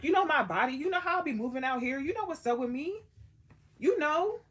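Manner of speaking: indignant friend at full volume; exasperated Jesus. Retold or imitated. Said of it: "Jesus felt a type of way".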